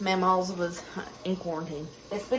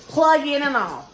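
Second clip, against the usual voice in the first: exasperated; 4 semitones higher, 6 dB louder than usual. Real, not acted. Exasperated about husband's mistake.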